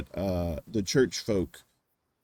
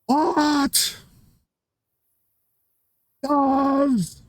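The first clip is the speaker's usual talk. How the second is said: extremely slow, high, loud stretched words; absurdly slowed cabbie impression; Reverend Jim; 4 dB louder than usual. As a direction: drag each word out endlessly, high and strained. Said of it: "asking the question slower each time".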